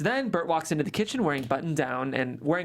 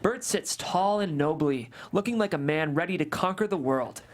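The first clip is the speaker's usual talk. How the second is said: ordinary delivery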